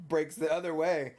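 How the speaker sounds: usual speaking voice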